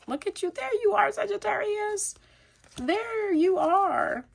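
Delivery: high-pitched coo, stretched out; delighted cooing. Real, not acted. Reader greets the Sagittarius card that popped out, high delighted coo. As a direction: coo it high, drawing out the last words.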